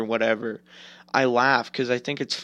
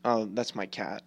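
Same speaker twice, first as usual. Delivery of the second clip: ordinary talk, nothing marked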